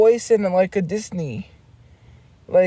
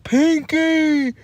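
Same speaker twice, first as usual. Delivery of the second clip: higher, put-on cartoon voice; cartoon character voice; 7 semitones higher, much slower than usual. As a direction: call "Pinky" in a squeaky cartoon voice. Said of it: "the one where he's like".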